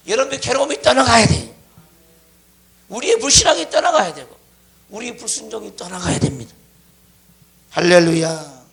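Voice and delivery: loud, driving preacher's shout; full-force preaching. Real, not acted. Preacher urging congregation to cast off sickness and unbelief, full-force preaching. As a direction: ring out each declaration forcefully.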